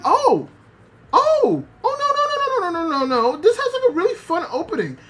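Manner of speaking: squealing disbelief, giggling through it; surprised reaction. Retold or imitated. Streamer reliving the anime opening, shocked and laughing. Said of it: "Like, I was surprised".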